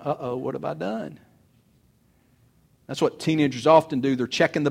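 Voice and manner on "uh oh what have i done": a soft, shocked "uh-oh" to himself; realizing the damage, hushed; quiet dismay. Retold or imitated. Teen realizes he hurt mom.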